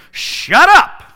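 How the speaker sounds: high, loud yell; shouted shut up. Acted. Preacher as Jesus silencing the demon, high loud yell. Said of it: "what Jesus is saying to the demon".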